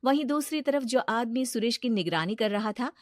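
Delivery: ordinary delivery, nothing marked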